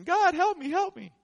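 hammed-up high cry for help; mock panic. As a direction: cry out like a man going under. Peter sinking, crying for help.

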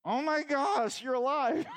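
high amazement building to a loud joyful cry; overjoyed amazement. Retold or imitated. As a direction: start awed, then burst out with joy. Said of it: "They're like".

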